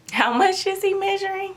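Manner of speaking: stunned mother asks again, high and disbelieving. Retold or imitated. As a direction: pitch leaps up in disbelief. Disbelief at eleven pound baby.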